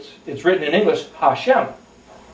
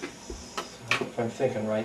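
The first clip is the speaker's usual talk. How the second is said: muttered aside; 6 semitones lower, 15 dB quieter, slower than usual. Real, not acted. Muttered aside while counting.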